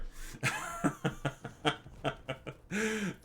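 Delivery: real, high-pitched laughing fit; long laugh. Real, not acted. Streamer cracking up with chat, long high laugh. Cracks up after stepdad slip.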